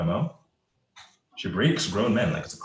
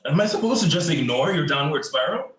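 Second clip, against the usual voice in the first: loud, high-pitched angry challenge; heated, indignant confrontation; exasperated outburst; 9 semitones higher, 7 dB louder than usual. Acted. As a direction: raise your voice in exasperation. Acted quarrel, exasperated challenge.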